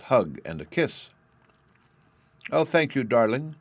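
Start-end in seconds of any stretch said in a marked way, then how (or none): 2.5-3.6 delighted cry. A narrator's reading. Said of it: "cried Sylvie".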